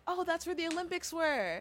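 high-pitched "oh" of realisation; imitated recognition. Older people hearing about Atlanta, imitated recognition. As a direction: mimic someone pleased to place it.

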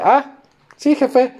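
high, eager little yes-man voice; mock servile reply. Johnny answering the boss, high mock-obedient voice. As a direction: play the lackey jumping at the order. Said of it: "Y me dice".

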